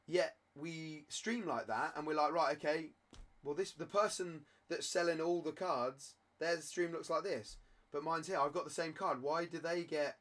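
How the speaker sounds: usual speaking voice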